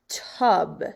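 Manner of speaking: "tub" with a short uh vowel. Shown as a demonstration. Teacher models the short vowel for second graders, "tub" with uh. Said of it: "So first up for short".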